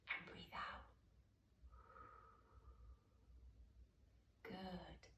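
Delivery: soft, hushed guided-relaxation voice; slow, drawn-out blowing words fading to near whisper; guided breathing. Shown as a demonstration. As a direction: lead children softly through each breath, with long pauses. Leads a breathing exercise.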